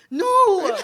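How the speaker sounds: high, loud pinched shout with laughter; hammed-up nasal wail; nasal mock cry. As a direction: yell it through a blocked nose. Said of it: "cut all circulation from your nose".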